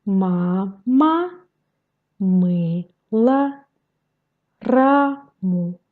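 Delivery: Ma, ma, мы, la, раму in separated syllables, paired into words; slow syllables for learners to repeat. Shown as a demonstration. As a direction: sound out each syllable, pausing between words. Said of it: "syllabe par syllabe".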